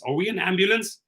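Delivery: ordinary talk, nothing marked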